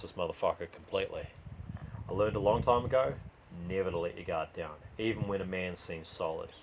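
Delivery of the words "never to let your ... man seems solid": plain reading voice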